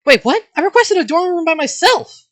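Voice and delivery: high, loud shocked outburst; outraged protest. Acted. Student learns of a shared dorm room, outraged protest. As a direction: protest at full pitch, shocked and indignant. Protests at being given a roommate.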